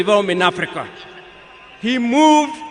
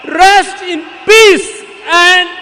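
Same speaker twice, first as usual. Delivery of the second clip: raised, loud, slow ringing farewell; rousing farewell; 6 semitones higher, 4 dB louder than usual. Real, not acted. Eulogist bidding Baba farewell before the mourners, loud and soaring. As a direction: call out the farewell to the fallen leader with full force.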